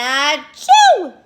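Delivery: loud mock achoo; sneeze. Teacher acting the bear's sneeze again, loud mock sneeze. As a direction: blast out a pretend sneeze. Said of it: "And he went".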